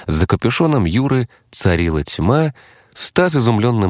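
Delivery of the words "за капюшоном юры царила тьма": ordinary delivery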